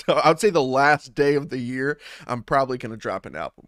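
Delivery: usual speaking voice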